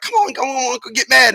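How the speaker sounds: high, insistent urging; egging on. Acted. Emotions urging mad on, high goading. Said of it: "emotions be like".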